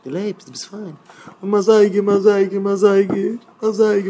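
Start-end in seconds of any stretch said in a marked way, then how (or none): 1.4-4.1 high, repetitive mock lament; imitating a frantic outcry, pitched high; mimicked screaming. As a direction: wail for the watch over and over. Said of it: "in der Tunge von Geschrei".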